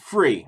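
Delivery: free set apart, falling firmly in pitch; stress on free. Real, not acted. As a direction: hit the word free. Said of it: "let me emphasize the free part".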